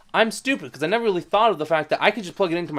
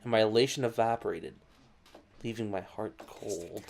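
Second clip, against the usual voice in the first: softer, sinking narration; quiet; 5 semitones lower, 7 dB quieter than usual. Acted. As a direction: let the words drop cold and quiet. Narration turns dark.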